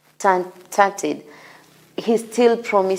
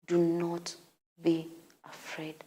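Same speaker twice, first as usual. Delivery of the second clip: much softer, said inwardly to yourself; inward confident self-talk; 13 dB quieter than usual. Shown as a demonstration. Preacher coaching listeners to tell their inner self, softly confident. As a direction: speak it to your own tired heart, hushed but sure. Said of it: "tell your inner self".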